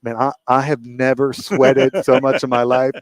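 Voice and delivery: reliving the terror of the track, drawn out; breathless relief. Retold or imitated. Driver after the test run, stunned and drawn out. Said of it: "and I'm like".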